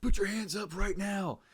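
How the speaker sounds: high-pitched urgent police order, imitated; cop's command. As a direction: act out a cop demanding surrender. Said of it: "And they're like".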